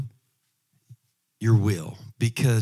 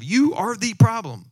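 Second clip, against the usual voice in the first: YOU stressed, falling, a touch slower; 9 semitones higher, 3 dB louder than usual. Shown as a demonstration. Preacher telling the flock to repeat "You are the problem" with YOU stressed. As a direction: lean on "You" and let it fall. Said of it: "That's what you gotta tell yourself".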